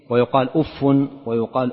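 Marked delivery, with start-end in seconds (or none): 0.5-0.8 أف with doubled f and an "-un" ending; damma with tanween. Shown as a demonstration. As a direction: pronounce أف as "uffun". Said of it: "وضمها وكسرها بتنوين وبغير تنوين".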